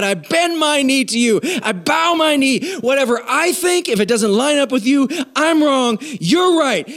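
impassioned preacher's prayer, pitched high; fervent, high-pitched declaration building without letup; giving it all. Real, not acted. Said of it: "I gave it all right there".

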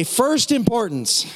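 "First importance." said slow and high, weight on "First"; first importance stressed. Shown as a demonstration. Pastor has the church repeat, slow and high. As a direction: lean hard into "First importance.".

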